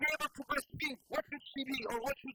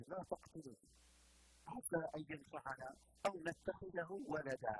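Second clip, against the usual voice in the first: soft plea; 10 semitones lower, 7 dB quieter, faster than usual. Acted. Asiya pleading for baby.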